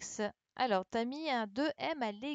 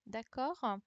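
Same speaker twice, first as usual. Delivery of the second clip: ordinary delivery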